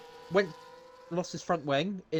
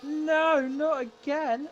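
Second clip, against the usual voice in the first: dismayed; much slower than usual. Real, not acted. Reaction to another crash.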